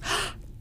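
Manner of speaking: sharp little gasp of recognition; acted-out gasp of surprise; mock astonished gasp. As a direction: imitate a stunned intake of breath. Retelling boy's astonished recognition.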